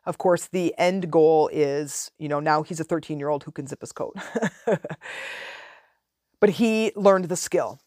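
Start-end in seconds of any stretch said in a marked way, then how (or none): 4.2-5.5 soft laugh at own remark; amused chuckle. Real, not acted. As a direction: chuckle quietly to yourself.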